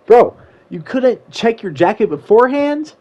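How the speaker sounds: high, exasperated incredulous question; upset disbelief. Retold or imitated. Boyfriend hearing the pen was in her jacket, exasperated. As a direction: retort in mildly upset disbelief. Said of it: "I was a little bit upset".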